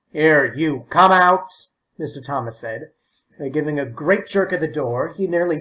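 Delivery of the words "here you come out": stern order. A narrator's reading. Old man orders the boy out of his loo, loud stern command. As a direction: order him out at once.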